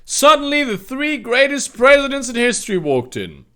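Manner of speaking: normal talking voice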